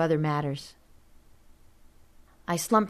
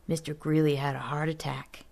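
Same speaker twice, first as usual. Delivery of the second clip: breathy secretive whisper; hushed urgent whisper to a friend; 2 semitones lower, 4 dB quieter than usual. Acted. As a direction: whisper it so the adults can't hear. Secret news kept out of earshot.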